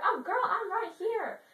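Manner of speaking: high, loud, slow mock-romantic call; mock eager suitor. Stalker suitor comes out of the bushes, hammed-up calling.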